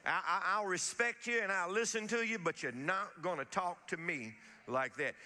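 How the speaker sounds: normal talking voice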